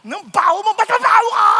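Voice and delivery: high loud yell, peaking at the end; escalating schoolyard shout; mock shouted retort. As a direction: yell the comeback to get even. Acted tit-for-tat insult.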